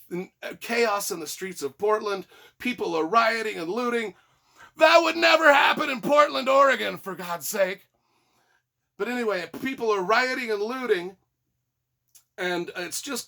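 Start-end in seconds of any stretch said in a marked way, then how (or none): 4.8-7.9 loud, high-pitched shout of disbelief; hammed-up indignant outburst; mock outrage. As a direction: shout it as a sarcastic protest. Mock protest about Portland rioting.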